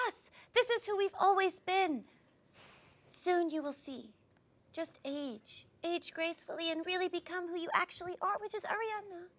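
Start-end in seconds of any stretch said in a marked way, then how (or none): 3.1-9.3 soft and wise. Acted.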